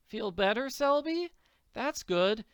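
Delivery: put-on high, friendly girl's voice; solicitous girl. Girl going to apologize to the hurt boy, solicitous.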